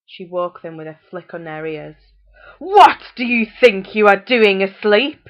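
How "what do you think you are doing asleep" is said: loud, slow angry shout; shouting mother. A narrator's reading. Mother shouting at her children to wake up. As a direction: bellow it at the sleepy kids. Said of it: "she shouted at her children".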